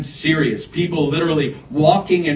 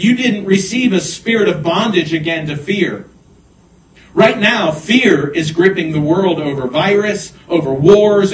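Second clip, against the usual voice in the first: raised pitch, emphatic preacher's delivery; urgent preaching; 5 semitones higher, 4 dB louder than usual. Real, not acted. Preacher warns congregation of fear gripping the world, urgent preaching. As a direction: drive the warning home, punching key words.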